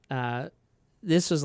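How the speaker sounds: ordinary talk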